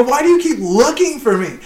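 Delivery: high, challenging question; retold going off. Worker confronting the boss, high mock challenge. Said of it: "start going off on him".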